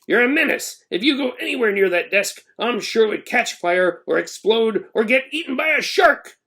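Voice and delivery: ranting principal. A narrator's reading. Principal rants at Ben.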